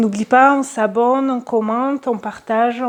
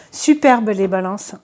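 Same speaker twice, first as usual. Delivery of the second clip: usual speaking voice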